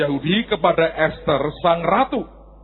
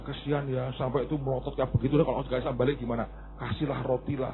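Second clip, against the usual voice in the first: pitying mother; 6 semitones lower, 11 dB quieter, faster than usual. Retold or imitated. Ibu iba.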